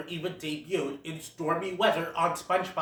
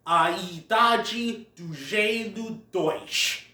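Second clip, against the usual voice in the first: slow, careful Portuguese pronunciation of the title; slower than usual. Shown as a demonstration. Giving the Portuguese title, careful Portuguese pronunciation. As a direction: say A Idade do Gelo dois slowly, the Portuguese way. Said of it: "as the Portuguese call it".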